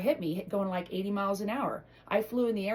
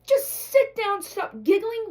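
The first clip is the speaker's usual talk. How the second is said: much higher and louder exasperated yell; retold yelling; 11 semitones higher, 10 dB louder, slower than usual. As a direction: blow up at the kids over homework. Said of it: "I was like".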